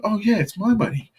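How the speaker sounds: high, cheeky fake claim; mock claiming. An imagined cheeky grab at the found money, high. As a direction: pretend it's yours, high and cheeky.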